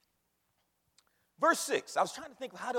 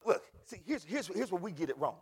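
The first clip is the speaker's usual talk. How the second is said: ordinary talk, nothing marked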